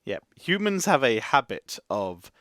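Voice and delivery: usual speaking voice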